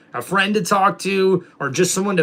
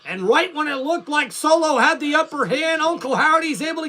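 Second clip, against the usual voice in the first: higher, excited wrestling commentator call; play-by-play commentary; 6 semitones higher than usual. Acted. Streamer calling the wrestling game for chat, excited play-by-play. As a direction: call the match like a TV announcer.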